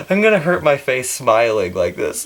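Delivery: normal talking voice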